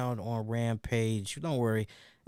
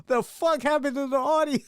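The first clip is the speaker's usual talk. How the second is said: mock listener; 13 semitones higher, 5 dB louder than usual. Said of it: "They're gonna be like".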